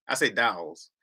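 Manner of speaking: ordinary talk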